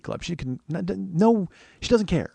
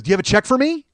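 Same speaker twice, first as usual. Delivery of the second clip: flustered guy; 11 semitones higher, 6 dB louder, faster than usual. Retold or imitated. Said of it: "flustered and panicked".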